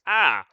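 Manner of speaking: startled yell; sharp exclamation. Acted. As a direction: bark out a startled "Ah!". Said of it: "freezes you in the same manner and goes".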